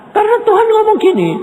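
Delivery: preacher racing into God's words; excited build-up. Real, not acted. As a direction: rush it up high toward the quote.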